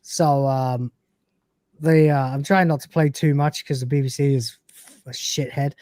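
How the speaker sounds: ordinary talk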